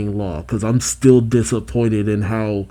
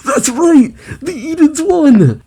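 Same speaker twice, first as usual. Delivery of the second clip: excited outburst; 4 dB louder than usual. Real, not acted. Reacting to a surprise plot twist.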